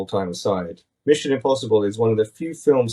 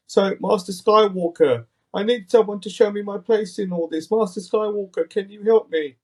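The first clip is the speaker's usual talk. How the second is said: high, pleading orphan voice; high-pitched mock waif impression; Oliver Twist voice; 10 semitones higher than usual. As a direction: beg for help like a little orphan. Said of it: "put on a little Oliver Twist voice".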